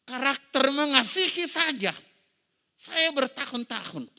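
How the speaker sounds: high, loud preaching to the congregation; forceful preaching. Acted. Pastor preaching to the congregation, high and loud. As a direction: drive it home from the pulpit.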